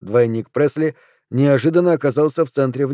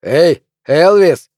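slow, loud heckler's call; raised, drawn-out holler; shouting out; 4 semitones higher, 7 dB louder, much slower than usual. A narrator's reading. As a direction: hail him across a noisy bar. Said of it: "крикнул кто-то".

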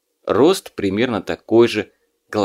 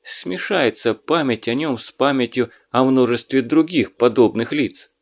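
usual speaking voice

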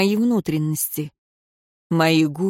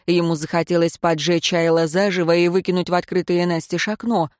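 ordinary delivery

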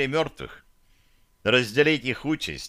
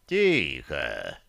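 very low, calm hush; calm demon command; 8 semitones lower than usual. A narrator's reading. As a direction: hush them without menace. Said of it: "без малейшей угрозы".

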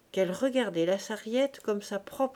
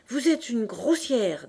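slow, indignant insult, a bit louder; indignant retort; 4 semitones higher, 5 dB louder, slower than usual. Acted. Fishwife insulting her rival, indignant retort. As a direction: deliver the insult with weight, drawn out. Two market women quarrelling.

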